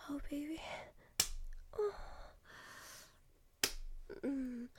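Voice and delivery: usual speaking voice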